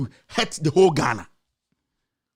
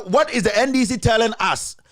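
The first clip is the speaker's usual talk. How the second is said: ordinary talk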